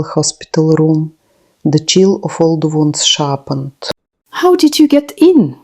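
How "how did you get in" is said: much higher, cold and unwelcoming; sick mother snapping at her estranged son; cold snap. A narrator's reading. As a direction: snap at him, you don't want him here. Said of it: "Milka snapped, cold and unwelcoming".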